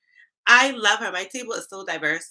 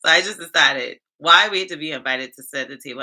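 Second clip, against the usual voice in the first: normal talking voice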